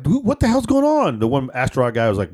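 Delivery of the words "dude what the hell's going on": high startled question. Retold or imitated. Driver reacting to angry Astros fans, high startled cry. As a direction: yelp it in surprise.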